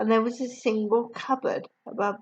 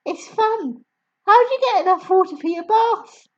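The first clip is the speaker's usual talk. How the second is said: put-on much higher, louder child's voice; little girl voice; 11 semitones higher, 7 dB louder than usual. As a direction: answer like an eager small girl. Matilda voiced.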